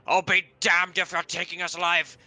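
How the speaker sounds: yelled last stand, high and loud; defiant shout. Acted. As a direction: bellow it like a cornered bandit. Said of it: "you hear the shout saying".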